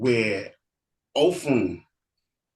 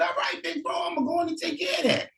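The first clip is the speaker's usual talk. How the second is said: put-on much higher, fast eager kid-brother voice; eager little brother; faster than usual. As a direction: chirp it back like a keen younger brother. Younger brother answers eagerly.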